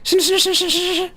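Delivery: high, fast mock wail; mortified wail. Embarrassed person squealing shinu, high mock wail. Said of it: "when people say".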